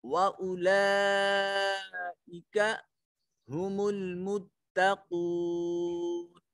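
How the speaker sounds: slow, drawn-out melodic tajweed recitation; Quran recitation. Shown as a demonstration. Teacher recites a verse for students, slow melodic chant. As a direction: chant each phrase slowly with long vowels.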